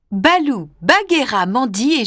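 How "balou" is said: ordinary delivery, nothing marked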